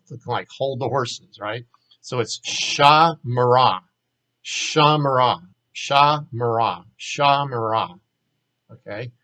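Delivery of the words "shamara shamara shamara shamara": slow "shamara", held first syllable, slight pause, then "mara"; slight pause after SHA. Shown as a demonstration. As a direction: say "shamara" as SHAA, mah-RAH. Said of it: "it means a slight pause".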